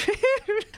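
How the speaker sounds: sudden high laugh. Real, not acted. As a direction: burst out laughing. Laugh burst mid-sentence.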